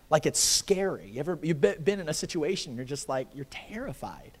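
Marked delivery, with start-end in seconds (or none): none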